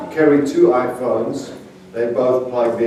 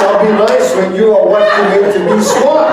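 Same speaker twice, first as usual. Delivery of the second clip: higher and louder comeback through laughter; laughing retort; 6 semitones higher, 5 dB louder than usual. Retold or imitated. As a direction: fire back at the phone while cracking up.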